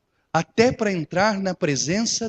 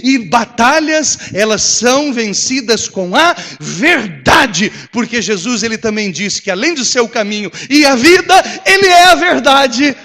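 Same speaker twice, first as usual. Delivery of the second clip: preaching at full force; 2 semitones higher, 6 dB louder than usual. Acted. Pastor building the sermon to a loud climax. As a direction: drive it home to the congregation.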